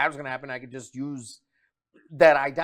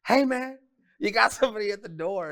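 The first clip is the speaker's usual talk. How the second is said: raised, higher call, like a buddy at the door; roommate calling out; 8 semitones higher than usual. Retold or imitated. Roommate calls him to the door, high and bright. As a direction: sing out the news to your friend. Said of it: "my roommate was like".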